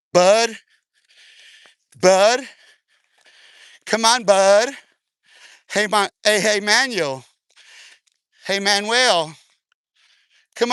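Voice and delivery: loud repeated call, rising on "on". Real, not acted. Streamer calling his buddy over, loud and coaxing. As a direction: call him over across the room.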